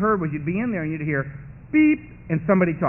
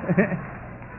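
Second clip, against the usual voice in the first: puzzled crowd; 6 semitones higher, slower than usual. Retold or imitated. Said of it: "The whole meeting's going".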